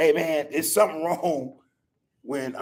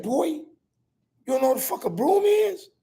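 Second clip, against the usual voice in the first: pitched way up in disbelief; incredulous grandpa. Retold or imitated. Grandfather asking grandson about the broom, incredulous and high. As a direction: ask it like you can't believe it.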